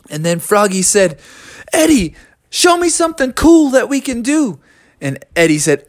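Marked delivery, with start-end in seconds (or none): 1.7-4.6 high, excited pleading; eager frog. Acted. Froggy flying beside Eddie, high eager request. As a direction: ask keenly in a raised voice.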